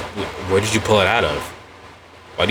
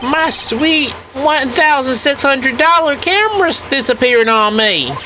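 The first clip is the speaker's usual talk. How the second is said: high, slow put-on accent of a fussy woman; terrible accent; slower than usual. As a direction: ham up a whiny lady's voice. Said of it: "That's a terrible accent".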